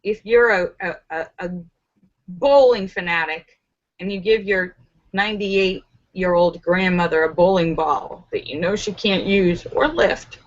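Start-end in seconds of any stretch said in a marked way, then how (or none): none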